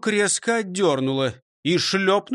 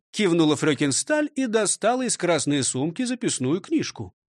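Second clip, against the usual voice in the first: plain reading voice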